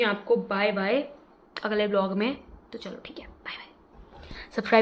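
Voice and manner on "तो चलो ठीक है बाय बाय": soft, slow whispered goodbye; whispering. Real, not acted. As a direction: breathe out the goodbye.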